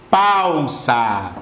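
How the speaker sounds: "Pausa" stretched into pau-sa; syllabified PAUSA. Shown as a demonstration. Teacher dividing the word for the class, syllable by syllable on "Pausa". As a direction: hold "Pau" long, then a separate drawn-out "sa". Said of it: "vamos nosotros a silabear".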